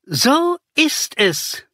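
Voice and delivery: a hidden villain stepping out, slowly; hard villain voice. Acted. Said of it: "kam es hart hinter uns". (hart und drohend, langsam, viel höher)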